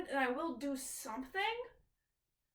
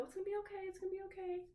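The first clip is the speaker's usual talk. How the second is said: soft, higher whispered-ish comfort; soothing self-reassurance; 6 semitones higher, 7 dB quieter, faster than usual. Retold or imitated. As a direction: softly talk yourself down. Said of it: "sit there and go".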